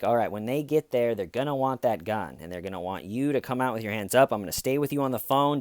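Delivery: ordinary delivery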